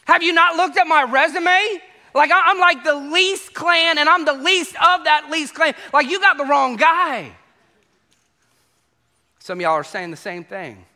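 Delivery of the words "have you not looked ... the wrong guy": high, loud disbelieving protest; can't believe he's picked, protest it; incredulous Saul. Retold or imitated. Saul protesting to Samuel, high incredulous voice. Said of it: "And he's like".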